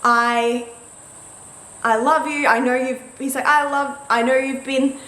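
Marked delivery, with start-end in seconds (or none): 0.0-3.0 imitating a halting, flustered proposer; tongue-tied. Partner struggling to propose, tongue-tied. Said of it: "started three different sentences". 3.5-5.0 halting false starts, higher and louder; tongue-tied. Retold or imitated. Partner popping the question, tongue-tied and halting. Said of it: "couldn't really get any words out".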